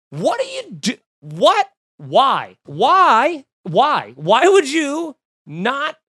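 high, loud repeated why, slowing at the end; exasperated disbelief, pleading at the man; baffled and exasperated at the driver; incredulous. Retold or imitated. Exasperated retold reaction to driver.

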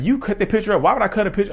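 high-pitched, protesting comeback; acted-out disbelieving counter-accusation; incredulous retort. Throws the accusation back in retold quarrel.

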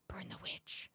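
unvoiced eerie whisper. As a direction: breathe it like a creepy hidden voice. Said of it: "all I'm hearing is".

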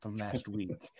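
low, softened nature-documentary narration; a hint of solemn nature narrator; lightly imitated documentary narrator. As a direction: lightly put on a hushed wildlife narrator. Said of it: "where it's like they'll say".

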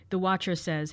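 usual speaking voice

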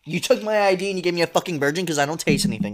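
loud, high angry complaining; rude customer. Pretend. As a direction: berate the waitress.

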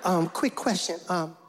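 hammed-up eighties rapper, hesitant and apologetic. Guest walking into the kitchen to ask about dinner, eighties rapper voice. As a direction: play a sheepish eighties rapper caricature.

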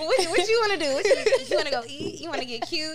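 pumping up a hurt friend; hyped-up friend. Retold or imitated. To a hurting friend, offering plans, hyped up. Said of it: "I'ma be the one to be like".

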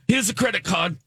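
a hint of a curt British set guy; dismissive British accent. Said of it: "a British set guy".

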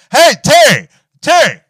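high, very loud holler; calling out, imitated. Mom summoning dad to the other phone, imitated yell. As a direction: yell the name across the house.

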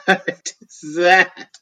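slow laughing amazement. Real, not acted. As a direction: laugh in disbelief.

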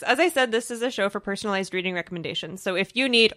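normal talking voice

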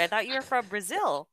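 ordinary talk

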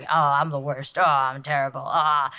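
low, mopey mock moan; grumpy self-pity. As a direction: mimic your own morning grumbling. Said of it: "I've been grumping around this whole house all morning".

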